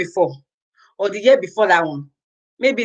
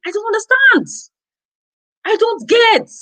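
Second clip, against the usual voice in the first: much higher, incredulous cry; can't believe it, voice shoots up; exasperated disbelief; 12 semitones higher than usual. Real, not acted.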